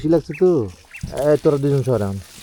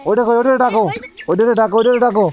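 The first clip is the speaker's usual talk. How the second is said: calling puppies to eat; 7 semitones higher, 3 dB louder than usual. Real, not acted.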